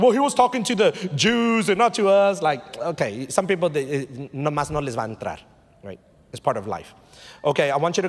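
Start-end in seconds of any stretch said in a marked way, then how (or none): 0.0-2.4 slightly raised voice of someone arguing back; lightly mock a higher-pitched objection; playing the arguer; imitated objector. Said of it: "they're gonna make the argument".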